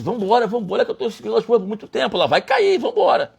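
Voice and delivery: high-pitched, rushed urging; acted-out impatient "let's go"; urgent hurrying. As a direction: hurry someone along before time runs out. Acts God hurrying him along.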